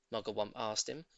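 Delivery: ordinary delivery, nothing marked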